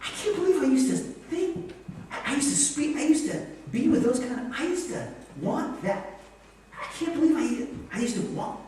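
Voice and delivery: high-pitched amazed realization; acted disbelief. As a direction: marvel at your old self, pitch way up. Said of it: "You're like".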